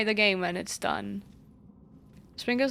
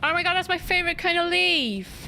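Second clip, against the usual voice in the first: excited squeal; 8 semitones higher, 3 dB louder than usual. Real, not acted. Finds item in game.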